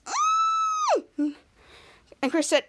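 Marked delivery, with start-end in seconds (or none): 0.1-1.0 high-pitched scream. Acted. Piper interrupting the family from inside, imitated high scream. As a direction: imitate a girl's long squeal of a scream. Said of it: "Piper screams".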